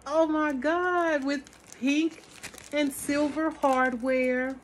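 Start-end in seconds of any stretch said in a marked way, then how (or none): none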